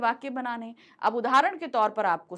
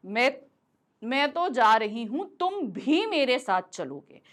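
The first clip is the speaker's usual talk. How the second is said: तुम भी pressed firmly, slow teaching pace; stress on तुम भी. Shown as a demonstration. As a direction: lean hard on तुम भी. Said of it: "अब तुम के साथ हमने भी लगाया".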